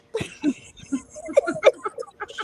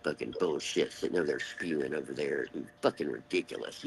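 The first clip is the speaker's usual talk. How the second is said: muttered curse; 12 semitones lower, 10 dB quieter than usual. Real, not acted. Angry muttering aside.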